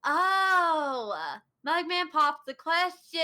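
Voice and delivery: retold dawning realisation. Retelling realisation on rereading title.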